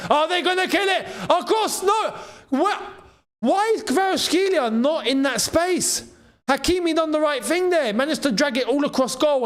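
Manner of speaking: excited rising shouts. Real, not acted. Fan watching PSG attack, excited rising shout. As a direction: yell at the screen as the chance builds.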